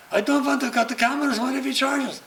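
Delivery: high, anxious protest from the cameraman; high, worried film crew voice. Retold or imitated.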